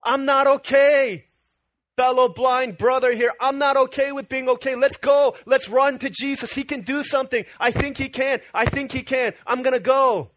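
high, impassioned declaration; rally a companion with urgent resolve; fired-up preacher acting out defiance; rising up determined. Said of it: "he rises up and he says".

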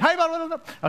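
high, bright mock-cheerful greeting to a cop; nervous politeness. Pulled over by a cop, high nervous chirp. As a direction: chirp it up high like a scared driver. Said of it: "I'm like".

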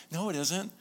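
high, playful no-it-isn't; bouncy, delighted contradiction; childlike denial. Retold or imitated. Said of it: "He goes".

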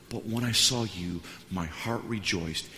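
slow right down and mean it; tender encouragement. Retold or imitated. Pastor encourages the church planter, tender.

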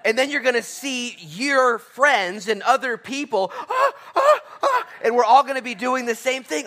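huge high gasps and laughing excitement; overwhelmed with delight at the sight; giddy gasping joy. Acted. Imagining arriving in heaven.

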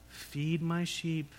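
slow, deliberate feed my sheep; slow solemn command. Retold or imitated. Jesus telling Peter, slow and solemn. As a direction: give each word weight. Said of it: "What does he tell him to do?".

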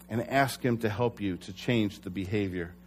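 ordinary talk, nothing marked

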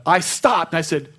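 usual speaking voice

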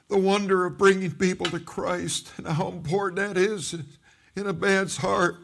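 real emotion, high strained voice; halting testimony with long pauses to regain control; choked up. Real, not acted. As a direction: confess it through welling tears. Moved testimony, long pauses, recalls weeping.

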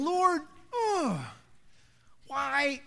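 high, drawn-out wail to heaven; mock-anguished plea. Man walking out of Walmart in shame to the Lord, mock wailing. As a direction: groan it out like a hammed-up lament.

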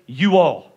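"You all" said as two full words, falling; "You all" spelled out slowly as a Southern form. Shown as a demonstration. Pastor to church, demoing a Southern way: "You all". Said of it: "some people say".

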